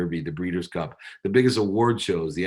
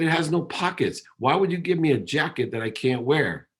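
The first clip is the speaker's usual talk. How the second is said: higher, hurt accusation at his dad; mild indignant outburst, higher; mock angry; 7 semitones higher than usual. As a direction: retell the furious phone call with a raised, pained pitch. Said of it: "I got so mad".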